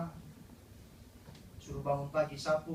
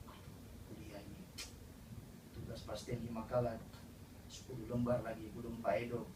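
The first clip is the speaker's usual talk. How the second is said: low, soft, mock-weary listing of lecturers; whiny student complaint; 7 semitones lower, 9 dB quieter than usual.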